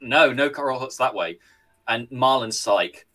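usual speaking voice

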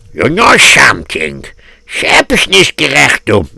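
put-on old-world Yiddish voice; gossiping gabbai. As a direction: play a gabbai sharing a suspicion. Shul gaboyim schmoozing in character.

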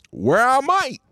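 high, sung-out throwback line; old intro callback. Shown as a demonstration. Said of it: "that's throwback right there".

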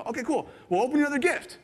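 eager prompting. Retold or imitated. Giver urging the next gift.

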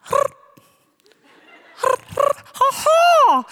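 a very high, loud hooting "ha ha"; mock bird call. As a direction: squawk it out like a bird. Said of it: "you have to guess what this is".

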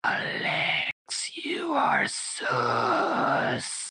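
whispered, slow accusation of Alex; accusing whisper. Pretend. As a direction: breathe the accusation slowly at Alex. Accusing friend of being imposter.

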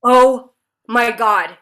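slow, loud stunned gasp of recognition; loudly floored, retelling it; stunned realization. Retold or imitated. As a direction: draw it out as the penny drops. Stunned realization of who he was.

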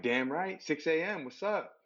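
high-pitched mock bragging; cocky comeback. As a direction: fire back proudly, playing it up. Retells his proud comeback to wife.

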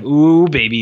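put-on smug ooh baby. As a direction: gloat over it, relishing. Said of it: "he's like".

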